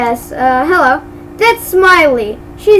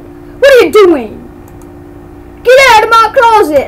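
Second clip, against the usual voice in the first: high, loud, quick angry outburst; angry janitor; 6 semitones higher, 4 dB louder than usual. Acted. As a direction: chase an intruder out, raised voice. Janitor snaps at intruding player.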